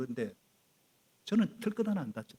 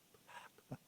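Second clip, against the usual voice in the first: whispered pat; 24 dB quieter than usual. Shown as a demonstration. Father patting his sleeping children, whispered. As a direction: whisper 토닥토닥 without voice.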